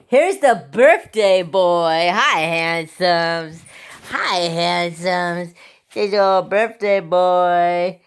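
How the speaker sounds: stretched-out, doting pet-owner coo; gushing pet talk. Real, not acted. Cooing to her birthday dog.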